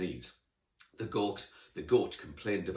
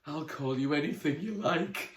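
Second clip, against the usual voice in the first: high, lovestruck sighing; dreamy sigh; 7 semitones higher, 3 dB louder than usual. Acted. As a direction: swoon over the unicorn. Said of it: "sighed dreamily".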